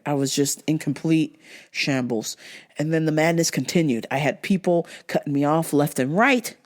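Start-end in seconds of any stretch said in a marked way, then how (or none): none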